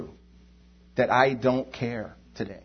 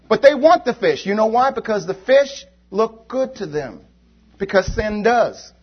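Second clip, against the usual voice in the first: high, loud, impassioned preaching; 7 semitones higher, 6 dB louder than usual. Acted. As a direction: preach it with fire to the congregation. Preacher voicing complaints, then exhorting.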